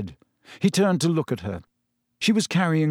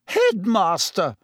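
high, drawn-out indignant protest; sputtering matron, appalled; 7 semitones higher, 3 dB louder, slower than usual. A narrator's reading. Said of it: "sputtered Madame Pomfrey".

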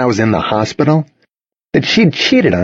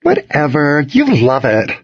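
higher-pitched teasing comeback; retort; 5 semitones higher than usual. A narrator's reading. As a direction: toss it back at him lightly. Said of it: "Charlotte retorted".

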